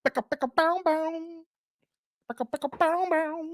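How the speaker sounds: high, soft imitated gun noises; playful pretend gunshots, voiced high; mock gunfire. Host answers "throw the guns up" with mock gunfire.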